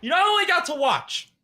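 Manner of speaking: high-pitched aggrieved plea; hammed-up hurt, pitched far above normal talk; mock-emotional. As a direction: plead your grievance in a high, wounded voice. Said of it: "I'm gonna get emotional again".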